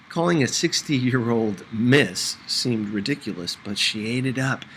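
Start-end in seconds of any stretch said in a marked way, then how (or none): none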